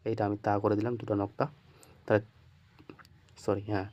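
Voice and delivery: normal talking voice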